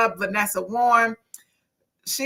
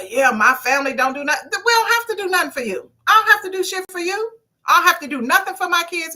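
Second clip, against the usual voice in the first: indignant retort; 7 semitones higher, faster than usual. Acted. Arguing back at grown kids.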